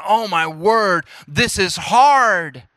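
slow, weary exasperation, leaning hard on the last word; exasperated speaker. Acted. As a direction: stretch it out like a struggling speaker. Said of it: "whoever's speaking and leading is going".

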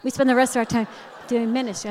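hammed-up dainty sing-song; mock sweet friend. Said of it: "in my head, I saw her leg go up".

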